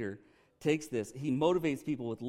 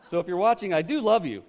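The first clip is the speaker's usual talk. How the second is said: laughing aside; 4 semitones higher, 8 dB louder than usual. Real, not acted. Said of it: "addresses the man directly, laughing".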